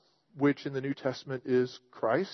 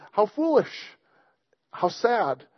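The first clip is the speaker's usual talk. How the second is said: higher, mock-pitying scoff; scoffing God; 6 semitones higher than usual. God scoffing at rebellious kings, pitying high voice. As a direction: shake your head at such foolishness. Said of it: "But he, he scoffs them".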